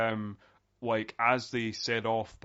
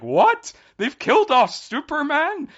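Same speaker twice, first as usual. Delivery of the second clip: loud, very high outraged shock; distraught disbelief; 12 dB louder than usual. Retold or imitated. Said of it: "I was genuinely distraught by".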